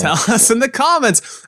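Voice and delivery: high, drawn-out YouTuber parody; mocking imitation. Podcaster parodies YouTubers begging for comments, mocking imitation.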